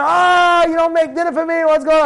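acted high-pitched outburst at a wife; mock domestic tantrum; angry husband. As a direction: explode over a missing dinner. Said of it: "Can I get angry?".

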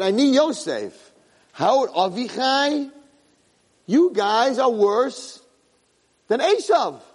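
slow, high-pitched rebuke as Yosef; Yosef rebuking brothers. Retold or imitated. Yosef scolding his brothers, slow and high. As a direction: drag out each word like a hurt accuser. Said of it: "So he said".

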